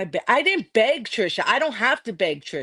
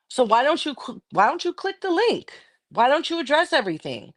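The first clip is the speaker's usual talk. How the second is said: climbing much higher on link, exasperated; heated demand; 6 semitones higher than usual. Real, not acted. Streamer dares her rival to join the call, heated. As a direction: demand she finally click the link.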